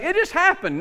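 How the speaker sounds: high-pitched mock teenager excuse; protesting teenager. Teenager excusing a clean room to a parent, mock protest. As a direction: plead innocence like a teenager. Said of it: "the teenagers could just say".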